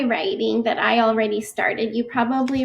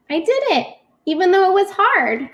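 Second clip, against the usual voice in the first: proud cheer; 7 semitones higher, 3 dB louder than usual. Acted. Teacher celebrating finished word.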